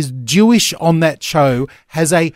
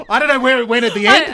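amused, higher voice through real laughter; laughing; 8 semitones higher, faster than usual. Real, not acted. Host reacting to co-host's wandering accent attempt, laughing. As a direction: talk while cracking up.

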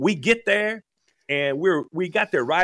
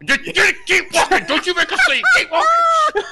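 high, loud, laughing imitation of wife; wife mock-scolding through a smile, higher and louder; mock under-breath; 6 dB louder than usual. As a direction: ham up her strained hiss-through-a-smile. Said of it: "talking under her breath".

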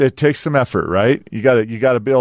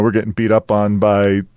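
usual speaking voice

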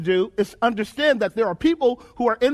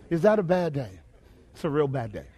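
low, subdued repeat; 9 semitones lower, 5 dB quieter than usual. Real, not acted. Preacher lets the bad day sink in, low and subdued. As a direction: voice dropped low, sinking away softly.

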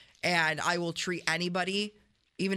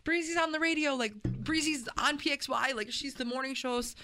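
high, hurried whoa-whoa backpedalling; calm her down fast, voice pitched high; imitated husband frantically explaining; panicked husband; 10 semitones higher than usual. Husband calming panicked wife.

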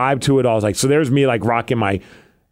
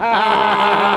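dad cheering; 7 semitones higher than usual. Retold or imitated. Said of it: "his dad's like".

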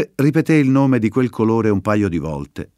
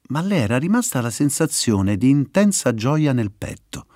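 usual speaking voice

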